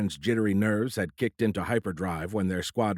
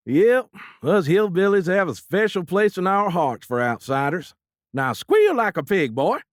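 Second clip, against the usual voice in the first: hammed-up hillbilly, high and loud; hillbilly accent; 10 semitones higher, 7 dB louder than usual. A narrator's reading. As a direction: play a menacing hillbilly, hammed up. Vic hams up hillbilly villain.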